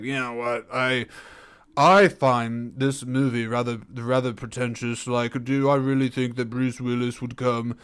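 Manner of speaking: dry, humorless critic voice; pretentious critic. Acted. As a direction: sniff at it like a snooty reviewer. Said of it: "that would be my, uh, very, very opinionated and dry and, uh, humorless, pretentious critic".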